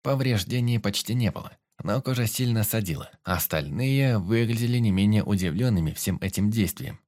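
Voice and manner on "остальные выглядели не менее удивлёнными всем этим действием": usual speaking voice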